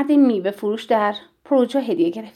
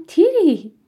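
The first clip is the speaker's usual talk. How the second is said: delighted greeting; 7 semitones higher, 5 dB louder, much slower than usual. A narrator's reading. Delighted greeting before an embrace.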